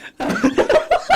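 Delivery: high burst of laughter; big laugh. Real, not acted. Laughs at listener message.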